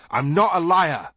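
high, loud desperate protest; screamed denial. A narrator's reading. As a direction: cry out your innocence. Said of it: "he screamed".

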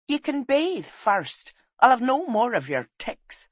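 high, firm wifely orders; bossy wife. Acted. Wife orders travel-worn husband to wash first, bossy.

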